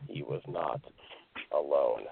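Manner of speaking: soft, drawn-out spooky reveal; quiet campfire horror cliffhanger; hushed suspense. Acted. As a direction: let each phrase hang ominously. Suspense climax of scary story.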